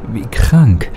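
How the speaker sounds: ordinary delivery, nothing marked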